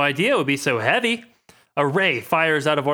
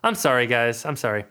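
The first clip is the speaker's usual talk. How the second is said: ordinary talk, nothing marked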